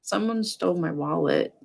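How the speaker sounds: a teacher showing the flat way to say it; flat, quiet retelling. Shown as a demonstration. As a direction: say it softly, matter-of-fact. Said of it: "So you're just like".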